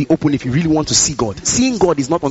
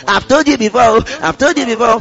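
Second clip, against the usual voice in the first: higher-pitched mock parental telling-off; parent's slamming scolding; 6 semitones higher than usual. Parent warning a child who did not listen, acted higher-pitched scolding. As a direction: slam it down like a fed-up parent. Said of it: "slamming it like the way your mother or your father".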